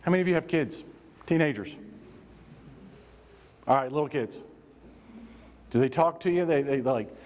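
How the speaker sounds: normal talking voice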